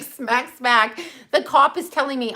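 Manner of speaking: normal talking voice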